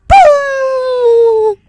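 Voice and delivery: high, slow, loud bird screech; hawk screech. Pretend. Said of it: "That's my Hawk impression".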